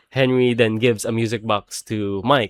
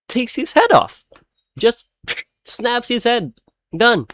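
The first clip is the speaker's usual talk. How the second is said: excited; 11 semitones higher than usual. Real, not acted. Telling viewers about the movie's wildest moment, excited and high. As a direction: blurt it out high and hyped. Excited retelling of shocking scene.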